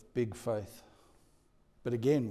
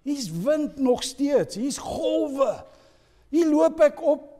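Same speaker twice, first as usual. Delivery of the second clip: Peter amazed; 9 semitones higher, 4 dB louder, slower than usual. Acted. Voices Peter amazed on the waves.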